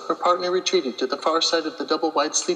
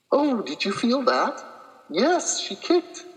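high, thrilled little exclamations; excited about the kick; 8 semitones higher, slower than usual. Acted. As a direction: react as if you just felt the baby kick. Feeling baby kick.

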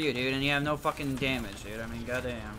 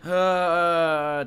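loud groan; 4 semitones higher, 9 dB louder than usual. Real, not acted.

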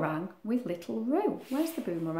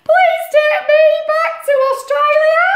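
very high, loud sing-song wail; wailing little Roo; 10 dB louder than usual. Acted. Little Roo begging to go home, high wailing. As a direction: plead in a crying sing-song. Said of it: "The wail grows wailier".

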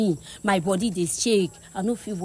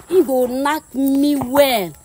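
drawn-out, louder WELL with relish; emphatic relish; 5 semitones higher, 6 dB louder, much slower than usual. Acted. Woman praising the boy over her husband, emphatic relish. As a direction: stretch out "knack me well", loud and slow.

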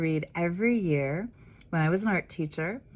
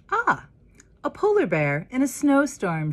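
high, louder teasing teacher voice; playful teacher; 8 semitones higher, 6 dB louder than usual. Acted. Teacher joking over the blank paper, high playful tease. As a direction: tease the child gently, pitched up high.

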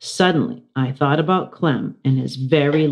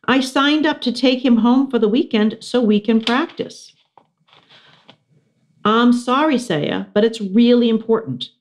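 put-on higher girl voice; Miranda voice; 8 semitones higher than usual. Miranda apologising to Saya, higher girl voice. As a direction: plead your case, voice lifted.

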